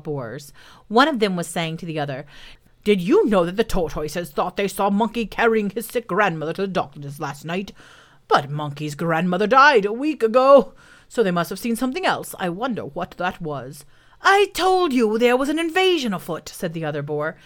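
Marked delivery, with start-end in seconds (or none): 2.8-13.8 gossiping boar character voice; put-on voice of a boar puzzling over news; wild boar. 14.2-16.5 high, loud boar character voice; self-important wild boar, higher and louder; indignant boar. Acted.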